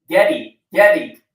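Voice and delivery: loud high calling out a name; mother yelling. Retold or imitated. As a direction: call the kid like a yelling mother.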